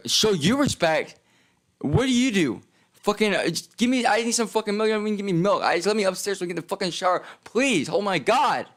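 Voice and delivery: frantic exasperation. Retold or imitated. To the guard demanding respect, high frantic exasperated pleading. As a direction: lose it while begging for milk. Yelling back at the shelter guy.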